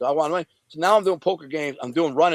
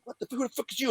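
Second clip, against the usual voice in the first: high, softer mock-startled voice; imitated confused man; 9 semitones higher, 8 dB quieter, faster than usual. Passed-out man woken by a stranger, high imitated confusion. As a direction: play him woken and bewildered, pitched up.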